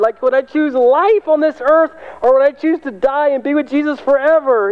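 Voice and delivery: high, full-voiced preaching, ramped up; fervent preaching. Real, not acted. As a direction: preach it with rising fervor.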